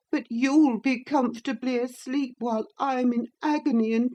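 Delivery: high, plaintive whine; high boy whining reproach; self-pitying sickly boy. A narrator's reading. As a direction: wallow in his misery.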